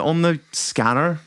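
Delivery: ordinary delivery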